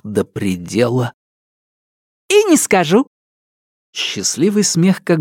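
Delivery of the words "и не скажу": high, gleeful teasing voice; snatch the secret away, delighted; teasing laugh. Acted. Nelidova teasing. (озорной девичий голос)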